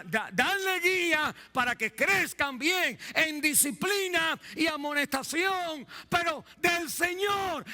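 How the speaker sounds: high, loud preaching climax; preaching shout. Real, not acted. Preacher shouting the key verse to the parents, high and loud. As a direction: hammer home the key verse.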